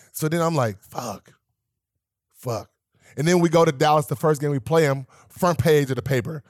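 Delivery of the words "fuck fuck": soft, sunken swearing; deflated, under-the-breath curse; retold dejection. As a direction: drop it quietly, a pause between. Retelling dejected reaction to online hate.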